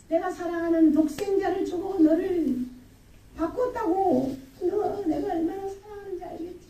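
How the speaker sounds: high, impassioned voice of a reproachful God; God pleading. Acted. God telling a straying believer of costly love, pleading reproach. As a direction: plead as a loving, wounded Father. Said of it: "하나님의 마음이에요".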